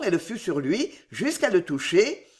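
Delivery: usual speaking voice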